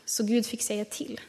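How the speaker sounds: ordinary talk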